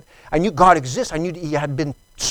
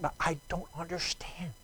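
very soft, breath-only whisper; whispered confession; 14 dB quieter than usual. Retold or imitated. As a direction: whisper your confusion as a private prayer. Retold private puzzlement to God.

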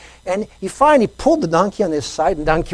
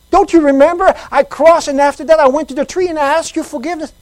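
louder, higher pleading voice; distressed man; 10 semitones higher, 8 dB louder, faster than usual. Retold or imitated. Man begging God to remember his sin, distressed pleading. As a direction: press the Lord, upset and insistent.

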